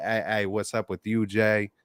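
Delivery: ordinary talk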